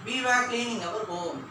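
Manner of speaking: very slow, word by word, for writing down; slow dictation. Shown as a demonstration. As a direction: spell it out slowly so they can copy. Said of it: "எழுதும் போது கவனிங்க". (மெதுவாக, எழுதுவதற்காக சொல்லுதல்)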